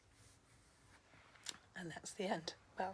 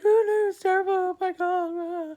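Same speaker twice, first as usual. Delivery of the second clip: hammed-up wail, much higher and louder; mock crying; 14 semitones higher, 18 dB louder than usual. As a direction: wail in exaggerated despair like a mock sobber. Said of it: "what are you crying for".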